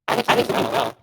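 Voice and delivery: broken robotic mic sound; distorted, cutting-out voice; glitchy audio. Real, not acted. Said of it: "You sound crazy right now".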